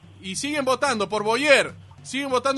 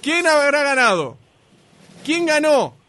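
announcer suspense; 3 semitones higher, 4 dB louder than usual. Real, not acted. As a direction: tease the winner with pauses between questions. Host builds suspense before result.

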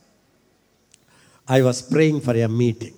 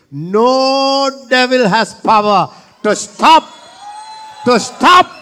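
preaching shout; 10 semitones higher, 8 dB louder, slower than usual. Real, not acted.

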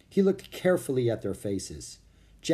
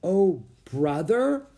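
slow, high question with rising end; puzzled echo; 7 semitones higher, 4 dB louder, much slower than usual. A narrator's reading. Priscilla echoes Jack's unfamiliar phrase, puzzled. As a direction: repeat it as if baffled. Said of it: "repeated Priscilla".

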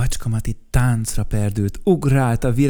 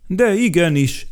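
insistent; 8 semitones higher, 6 dB louder than usual. Acted. Insisting against the boy.

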